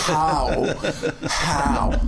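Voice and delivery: mock disbelief through laughter; laughing disbelief. Imitating a stunned viewer, laughing. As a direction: ask it while cracking up. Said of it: "You're like".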